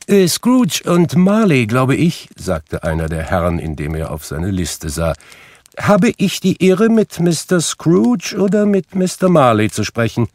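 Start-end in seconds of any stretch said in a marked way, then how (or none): 0.1-2.3 high, hesitant, formal inquiry; high-pitched, officious visitor; courteous gentleman. A narrator's reading. Visiting gentleman checks his list. 5.8-10.3 very high, formal visitor's voice; high-pitched, officious charity caller; courteous gentleman. A narrator's reading. Visiting gentleman formally addresses Scrooge.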